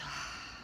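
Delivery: long unvoiced out-breath, letting go; releasing sigh. Shown as a demonstration. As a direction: sigh out all the tension. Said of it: "I did this".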